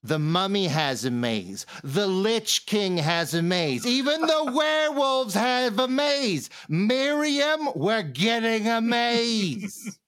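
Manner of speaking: building from measured to high, drawn-out whine; slow, escalating mock complaint; exasperated monster envy. Nagging Miriam about getting a maze, climbing comic complaint. Performs comedy card.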